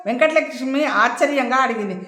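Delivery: usual speaking voice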